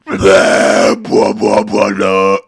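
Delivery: loud, low, harsh bass-drop growl; dubstep growl. Retold or imitated. Mocking the dubstep track, low loud growl. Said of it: "Not like".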